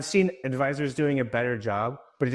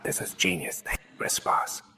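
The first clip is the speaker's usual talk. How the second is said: whispering; 5 semitones lower than usual. Retold or imitated. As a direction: whisper it under your breath. Whispered aside about Chris Voss.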